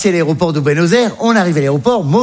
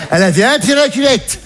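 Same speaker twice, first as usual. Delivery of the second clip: usual speaking voice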